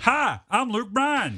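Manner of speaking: slow, lightly put-on country star voice. Shown as a demonstration. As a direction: drawl the name slowly like a weak impression. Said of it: "How's my [name] coming along".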